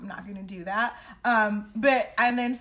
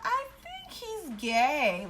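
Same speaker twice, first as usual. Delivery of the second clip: confused musing; 6 semitones higher, much slower than usual. Retold or imitated. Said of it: "I was like".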